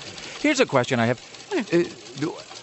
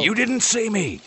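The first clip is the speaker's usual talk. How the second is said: mock sneaky husband; 10 semitones higher, slower than usual. Husband sneaking home at night.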